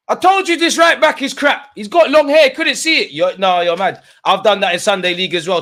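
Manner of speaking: high, loud fired-up rant; excited rant. Real, not acted. Fan ranting to chat about the defender, high loud excited voice. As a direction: rant at the screen.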